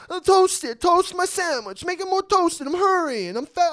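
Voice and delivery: mocking high-pitched, loud customer squawk; hammed-up impatient demands, shrieked high; frantic customer. Acted customer hurrying the sandwich maker.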